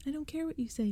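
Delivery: put-on high, fierce kid's shout of defiance; angry yell. A narrator's reading. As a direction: yell it at your brother, stamping. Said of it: "she yelled angrily".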